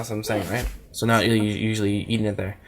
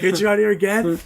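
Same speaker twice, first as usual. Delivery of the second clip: mock shop owner; 7 semitones higher than usual.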